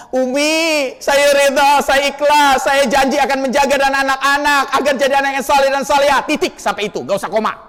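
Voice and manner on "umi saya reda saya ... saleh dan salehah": high, overdone solemn vow; mock husband's promise. Preacher mocking husbands who promise their wives, high and overdone. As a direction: ham up a pious husband's pledge. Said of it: "Nggak usah ngomong".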